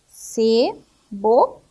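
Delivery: "Cebola" very slow, in separate beats; syllable by syllable. Shown as a demonstration. Teacher answering what the syllables form, "Cebola" slowly split up. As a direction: sound out CE, BO, LA with clear gaps. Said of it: "Nós podemos formar o quê?".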